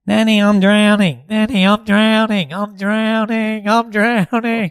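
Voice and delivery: high, loud, slow drawn-out wail; child yelling. Retold or imitated. Dad imitates his son yelling to nanny, loud slow wail. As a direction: call out like a kid sliding down a hospital bed. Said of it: "and he'll yell out".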